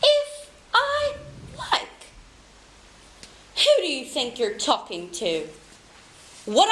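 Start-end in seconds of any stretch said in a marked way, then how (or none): 0.0-1.9 mocking echo. Boss mockingly repeats employee.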